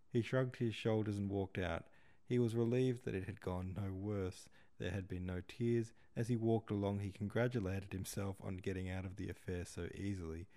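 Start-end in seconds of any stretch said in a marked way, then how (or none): none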